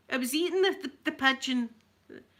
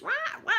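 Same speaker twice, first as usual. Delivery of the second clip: seagull squawk imitation. Explaining why the recording stops, a mock seagull squawk. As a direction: squawk like a gull outside the window. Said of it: "and squawk like that".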